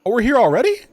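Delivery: high, loud startled exclamation; real surprise at arriving so soon; surprised. Real, not acted. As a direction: caught off guard by arriving. Sudden arrival at the destination.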